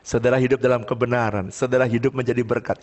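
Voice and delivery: normal talking voice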